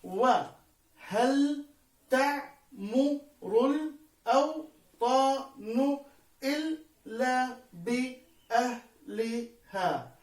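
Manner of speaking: very slow, each syllable set apart; teacher scanning a verse slowly, pausing after every syllable. Shown as a demonstration. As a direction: chop the verse into separate sound units. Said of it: "قد قسمنا المقاطع الصوتية".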